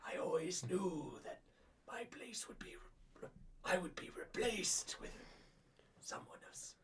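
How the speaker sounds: put-on whispery, strained old wizard; slow, hushed, halting elder; frail old man. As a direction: breathe it out like a moved ninety-year-old. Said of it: "he has a really raspy, like, strained old man voice".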